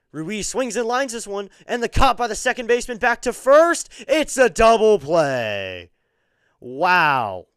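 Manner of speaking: loud, high shout on the double play; loud slowed Wow of disbelief; excited call. Real, not acted. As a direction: cry out in dismay at the double play.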